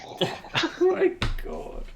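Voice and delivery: laughing oh my god, slow and high. Real, not acted. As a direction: lose it laughing mid-word. GM breaks into laughter.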